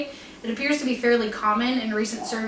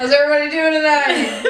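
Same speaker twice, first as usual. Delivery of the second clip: loud emcee greeting; stand-up host; 4 semitones higher, 6 dB louder than usual. Acted. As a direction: work the crowd like a comic. Comedian host voice.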